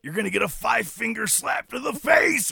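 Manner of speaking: high, shouted promo voice; Macho Man shout. Retold or imitated. Macho Man threatening the suit, shouting high. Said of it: "Something like".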